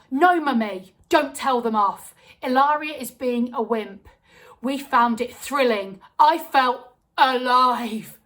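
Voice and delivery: loud, slowing, breathless thrill; little sister telling mum the ride was thrilling, loud and wild. Acted. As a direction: drunk on the adrenaline, loud. Thrill-crazed sister defends the ride.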